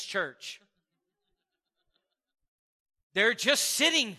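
preacher building to a climax about Jesus teaching all day; preaching at full force. Real, not acted. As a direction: preach it to the room.

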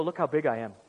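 low, lightly voiced bragging mountain; low mock-menacing boast; boastful mountain. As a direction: play a mountain showing off its size. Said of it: "the mountain will stand there and go".

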